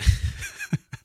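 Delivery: short breathy nervous giggle; a quick uneasy laugh. Real, not acted. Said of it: "that's my nervous laugh right there".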